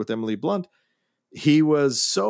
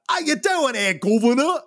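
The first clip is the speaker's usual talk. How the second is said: overdone mock Cockney, pitched higher; 11 semitones higher than usual. Host mocking an overdone Cockney accent, high and hammed. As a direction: ham up a fake Cockney greeting. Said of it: "he isn't overdoing it".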